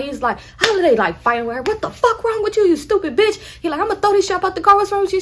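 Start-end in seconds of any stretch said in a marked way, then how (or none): 1.6-5.2 angry yell. Retold or imitated. Nephew fights aunt in car.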